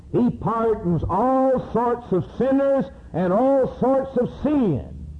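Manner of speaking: high, ringing climb into sorts of sin, slowing at the end; soaring pulpit cry; rising preacher fervor. Real, not acted. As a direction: lift it to a preaching peak.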